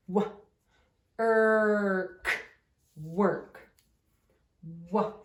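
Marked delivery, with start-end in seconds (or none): 0.1-3.6 "W. Irk, work" split then blended; sounding out. Shown as a demonstration. Teacher sounds out work for the class. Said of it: "Did you do your work".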